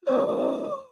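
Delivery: high audible gasp; sharp gasp of reaction. Real, not acted. Reacting to Whitney challenging Meredith, gasping.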